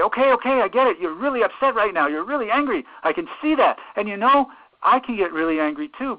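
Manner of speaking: loud, high-pitched, heated empathy; agitated matching. Acted. Therapist meeting an upset teenager where they are, loud and agitated. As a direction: meet their anger with equal force and urgency. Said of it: "You may need to go in and say".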